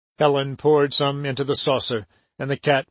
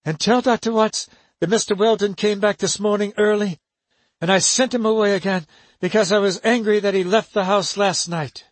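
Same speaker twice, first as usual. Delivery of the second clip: put-on higher, urgent young woman; urgent instructions; 6 semitones higher than usual. A narrator's reading. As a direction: press her to hurry. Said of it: "Helen O'Malley said".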